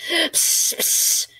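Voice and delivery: unvoiced spitting psst sounds; spitting hiss. Lizard spitting out the coffee, hissing psst. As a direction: spit it out like a disgusted lizard. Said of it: "he spat".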